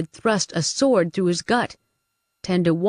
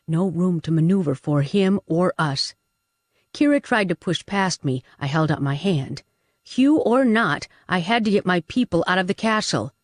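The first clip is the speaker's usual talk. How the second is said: plain reading voice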